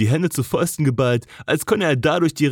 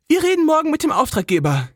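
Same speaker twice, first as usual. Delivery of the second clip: brisk, cool business tone; smooth, professional; 11 semitones higher, faster than usual. Acted. Jess to Cole, regaining composure, crisp and professional. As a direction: shut the moment down briskly. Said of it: "Ihre Stimme war wieder glatt, professionell".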